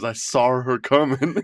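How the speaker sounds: "saw" said as "sawr" with an added R, lightly imitating a Boston friend. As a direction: slip an R onto the end of "saw". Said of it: "he puts that R on saw".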